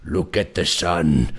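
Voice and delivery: low, slow dopey villain; dopey deep voice. Retold or imitated. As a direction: drawl it in a deep goofy voice. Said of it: "my guy's just like".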